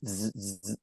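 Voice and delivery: soft repeated printer buzzes; imitated wire machine. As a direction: imitate an old news wire in short buzzes. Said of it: "the A P wire that would just".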